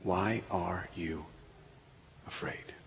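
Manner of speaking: God whispering in your ear. Acted. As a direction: breathe it into their ear. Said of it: "God whisper that question".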